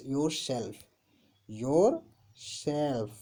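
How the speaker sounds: "yourself" drawn out with a gap after "your"; split in two. Shown as a demonstration. Teacher spelling out the pronoun for students, split in two. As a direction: say "yourself" slowly, "your", pause, "self". Teacher repeats word in parts.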